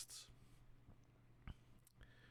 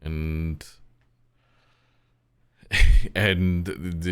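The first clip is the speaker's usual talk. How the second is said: usual speaking voice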